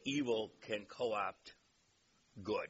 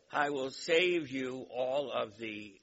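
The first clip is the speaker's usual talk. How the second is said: ordinary talk, nothing marked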